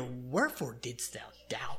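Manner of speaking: slow hushed whisper of the question; whispered rebuke. Acted. Jesus to sinking Peter, a slow whispered rebuke. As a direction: breathe the rebuke out slowly, no voice.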